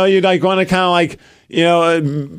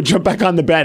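ordinary talk

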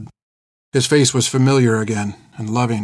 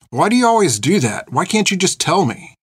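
higher, exasperated plea; frustrated pressing; 7 semitones higher than usual. Acted. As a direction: press him in mild exasperation. Girl presses the old man.

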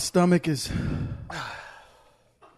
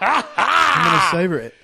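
slow, loud, hyped declaration; excited shout; 5 semitones higher, 8 dB louder, slower than usual. Real, not acted. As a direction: belt it out slowly, pumped up. Hyped joke about spicy gift.

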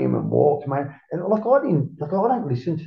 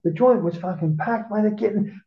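ordinary talk, nothing marked

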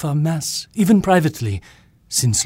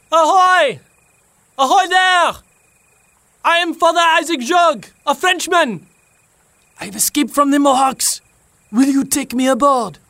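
shouting across the water; calling out; 12 semitones higher, 4 dB louder than usual. Acted. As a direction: call out loud to the ship. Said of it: "risked calling out".

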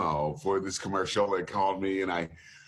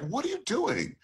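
high-pitched imitation of a baffled producer; mock exasperation; 12 semitones higher than usual. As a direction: act out an incredulous client, pitched up. Producer reacts to bad take.

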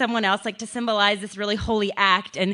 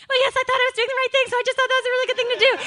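much higher, rushed mock mom voice; mom's excuse; 14 semitones higher, much faster than usual. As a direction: rattle it off like a flustered mom justifying herself. Mom voiced defending herself.

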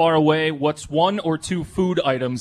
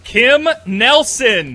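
calling out; 8 semitones higher, 8 dB louder, much slower than usual. Real, not acted. Calling out winner's name.

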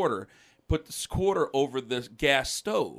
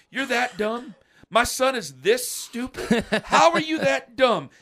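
high, raised, incredulous questions; imitated appalled dad; 10 semitones higher, 5 dB louder than usual. Dad complaining to mom about son, high mock disbelief. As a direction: marvel loudly at the stupidity.

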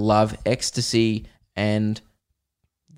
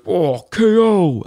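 game announcer; 6 semitones higher, 3 dB louder, slower than usual. Retold or imitated. Imitating Street Fighter's KO call, slow announcer voice. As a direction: boom it out like the game. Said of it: "it goes".

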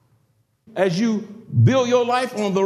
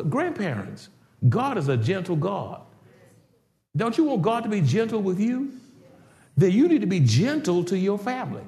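usual speaking voice

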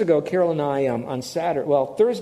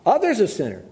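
indignant neighbor; 4 semitones higher than usual. Retold or imitated. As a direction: protest your innocence, all worked up. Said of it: "as he said it".

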